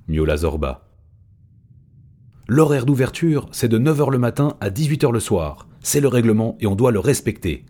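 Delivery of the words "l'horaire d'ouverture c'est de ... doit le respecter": high, loud squawking monkey voice; yelping chimpanzee. A narrator's reading. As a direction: yap it out like a cranky ape. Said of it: "glapit Mathias".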